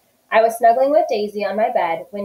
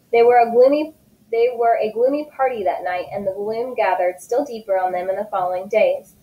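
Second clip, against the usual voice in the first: usual speaking voice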